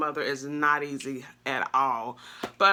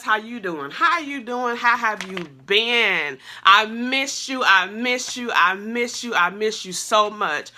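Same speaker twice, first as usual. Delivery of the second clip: excited greeting; 6 semitones higher, 5 dB louder than usual. Real, not acted. Greeting viewers after years away.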